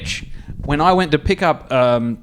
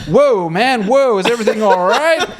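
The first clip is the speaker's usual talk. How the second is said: mock startled bystander; 7 semitones higher, 5 dB louder than usual. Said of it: "This dude's just like".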